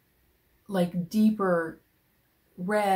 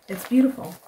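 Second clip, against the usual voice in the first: higher, louder praise; gushing; 6 semitones higher, 9 dB louder, faster than usual. Real, not acted. Admiring Jude's yarn, gushing. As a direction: gush over it.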